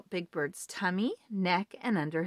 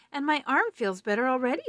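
higher, louder, quick chatter; eager Grover; 6 semitones higher, 5 dB louder, faster than usual. Acted. Grover telling David about his arm, quick eager voice. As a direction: rush it out happily.

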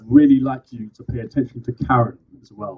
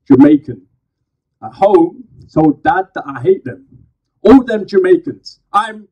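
spoken word poem; 8 dB louder than usual. Acted. Poet performing to a live crowd, loud slam declamation. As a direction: declaim each phrase to the crowd.

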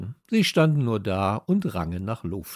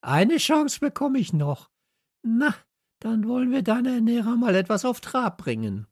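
mocking echo; 13 semitones higher, 4 dB louder than usual. Hasso mocks Steffi's promise to the other dog, high sneer.

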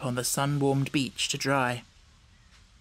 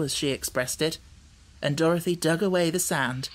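plain reading voice

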